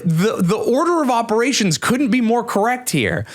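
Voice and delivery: high-pitched, worked-up protest; indignant rant. Real, not acted. Comedian defends mocking famous millionaires, indignant rant. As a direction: argue it heatedly, voice pitched high.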